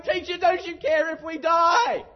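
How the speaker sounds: high, loud panicked yell; terrified shout. Retold or imitated. Disciples waking Jesus in the storm, terrified shout. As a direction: scream it over the storm in terror. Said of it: "they wake him up going".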